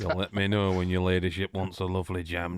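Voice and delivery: low, put-on northern gamekeeper voice; Sean Bean. Co-host riffing on the Lady Chatterley line, deep northern impression. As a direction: drop the pitch for a broad northern advert voice. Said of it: "I always think of Sean Bean".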